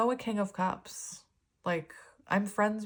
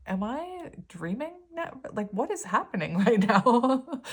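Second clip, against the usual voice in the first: higher, laughing, amazed; mock disbelief; 6 semitones higher, 5 dB louder than usual. Reader retells her amazement at her friend, laughing disbelief. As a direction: act stunned, laugh at the end. Stunned by friend's kind reply.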